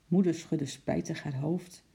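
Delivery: plain reading voice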